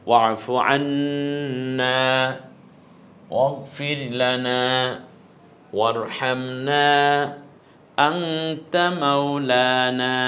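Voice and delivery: slow chanted recitation with held alifs; Quran recitation. Shown as a demonstration. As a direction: intone the verse ending slowly. Recites verse ending.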